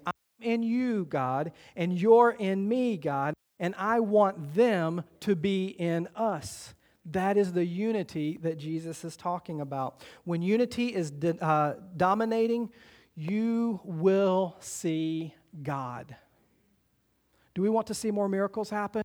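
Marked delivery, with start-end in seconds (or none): 0.1-6.7 slow, emphatic voicing of Jesus; slow preaching. Shown as a demonstration. Pastor voicing Jesus to congregation, slow and emphatic. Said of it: "Jesus is saying". 13.1-16.2 slow emphatic declaration. Acted. Preaching emphatic line.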